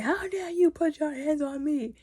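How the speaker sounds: high, offended voice; indignant youth. Retold or imitated. Harwin's son shouting at Aemond's mentor, indignant. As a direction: play the affronted boy. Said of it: "he goes like".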